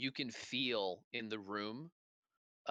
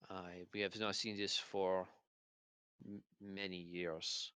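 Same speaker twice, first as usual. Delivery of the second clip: put-on slight French accent; 2 semitones lower than usual. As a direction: speak with a light French accent. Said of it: "a slight French accent".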